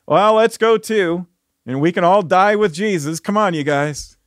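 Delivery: mock-cheerful rallying of doomed friends; sarcastic. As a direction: say it sarcastically, humour covering fear. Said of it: "says sarcastically and humorously, but also maybe to hide his fear".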